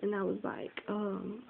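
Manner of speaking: ordinary talk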